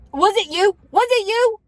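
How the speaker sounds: high, loud, urgent questioning; frantic demand to know; scared mom. Retold or imitated. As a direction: imitate a mother in a panic, voice shooting up. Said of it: "she's so scared".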